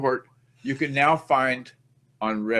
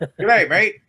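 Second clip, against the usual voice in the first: put-on a jokey, louder stab at an Australian accent; a brief mock Australian accent, a bit higher and louder; 5 semitones higher, 6 dB louder than usual. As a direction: play it up like a hammed-up Aussie greeting. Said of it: "work on my Australian accent".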